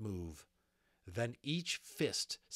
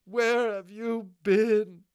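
high, very loud, drawn-out scolding shout; 12 semitones higher, 14 dB louder, slower than usual. Acted. Molly scolds the unicorn like a strayed cow, shouting. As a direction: scold at full voice, leaning on each word. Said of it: "scolding her as though she were".

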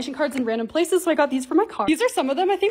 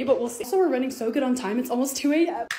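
ordinary delivery

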